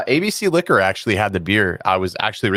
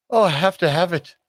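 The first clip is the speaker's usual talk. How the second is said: higher, breathy-free pleading want; mock gasp of desire; 8 semitones higher than usual. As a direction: act out the craving you felt. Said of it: "I said to myself".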